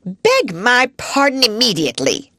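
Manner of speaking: high, loud haughty demand; stern headmistress. Acted. Headmistress ordering Sarah to apologise, high and loud. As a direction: command an apology from a pupil.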